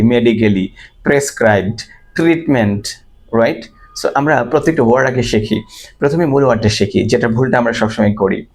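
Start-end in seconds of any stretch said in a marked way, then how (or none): 0.0-2.8 slow "medically prescribed treatment", every syllable sounded; common mispronunciation. Shown as a demonstration. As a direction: say "medically" as me-di-ka-lee and "treatment" with a full "ment". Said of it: "এভাবেই বলি". ("medically" ভুল উচ্চারণে "মেডিক্যালি")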